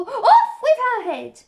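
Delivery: high, loud, stretched-out death sentence; furious shouting. A narrator's reading. Queen condemns players one by one, shouting furiously. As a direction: bellow it in a royal rage. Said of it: "stamping about and shouting".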